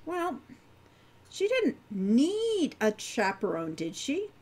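usual speaking voice

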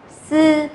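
"sư" as s with clear friction, high, level; hissed S. Shown as a demonstration. Teacher rereads third sound for students, "sư" with friction. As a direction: hold "sư" long after a hissed start. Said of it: "cô đọc lại ba âm này".

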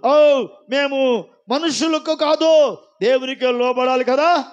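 slow, heavy stress on కాదు; firm apostle's declaration. Acted. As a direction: drag out కాదు with calm conviction. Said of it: "అపోస్తులు అంటున్నాడు".

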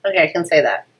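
ordinary delivery

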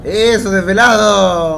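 loud, slow, drawn-out cheer; excited shout. Real, not acted. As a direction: cheer the donor at full voice. Thanking a donor on stream.

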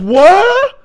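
high put-on girl voice, baffled; mock Sakura. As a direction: play her baffled and shrill-free but high and loud. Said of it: "And she goes".

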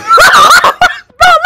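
very high, loud shrieking laugh. Real, not acted. Storyteller cracking up at the open-palm slap, laughing.

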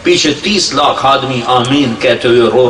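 ordinary talk

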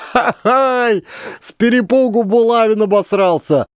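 high, laughing jeer; laughing crowd. A narrator's reading. Crowd laughs at the soiled commandant, jeering. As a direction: laugh it out mockingly. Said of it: "заколыхалась в смехе толпа".

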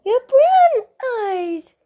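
high, loud, drawn-out coo; pet cooing. Real, not acted. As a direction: gush slowly up high at your dog. Cooing over the dog's eyes.

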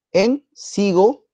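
CIGO- stretched out, high and left hanging; leading prompt. Shown as a demonstration. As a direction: draw out "cigo-" slowly with a rise, inviting completion. Teacher cues student to finish word.

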